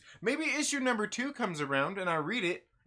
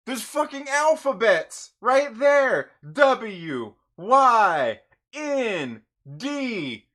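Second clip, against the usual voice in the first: louder, hammering each word; exasperated outburst at the obvious clue; loud rant; 4 semitones higher, 8 dB louder, slower than usual. Real, not acted. Reviewer to viewers, ranting about the letters on the cover, loud.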